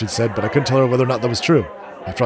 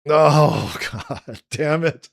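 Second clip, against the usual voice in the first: laughing; 4 semitones higher, much slower than usual. Real, not acted. Frustrated at the game.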